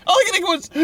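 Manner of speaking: high squeal through laughter; helpless laughing. Real, not acted. Host describing the steamroller death, helplessly laughing. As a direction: barely get the words out, cracking up.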